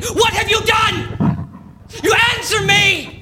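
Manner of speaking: high, loud slow scream of rage; screaming at the ceiling. Acted. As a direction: hurl it upward at the creature. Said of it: "I'm screaming up at the ceiling".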